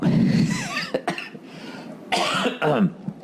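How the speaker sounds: rough real coughing fit; hacking cough mid-set. Real, not acted.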